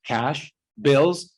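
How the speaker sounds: ordinary talk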